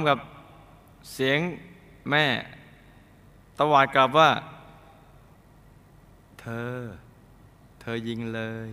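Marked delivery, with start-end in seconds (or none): 6.4-8.7 soft, sweetly daring "เธอยิงเลย" instead of a snarl; too sweet defiance. Retold or imitated. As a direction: dare him gently, almost tender. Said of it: "หวานไปนะ". (ท้าด้วยน้ำเสียงหวานเกินไป เบาๆ)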